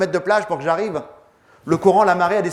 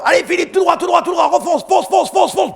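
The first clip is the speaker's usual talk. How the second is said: much higher, louder shout; shouting from the dark; 5 dB louder than usual. Retold or imitated. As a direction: call him straight ahead through the night. (Jacques crie dans la nuit, cri aigu et fort)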